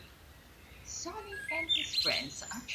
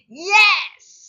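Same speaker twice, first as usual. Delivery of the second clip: high, very loud happy shout; joyful yes; 10 semitones higher, 20 dB louder than usual. A narrator's reading. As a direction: burst out with a big cheerful yes. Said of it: "Sonny says".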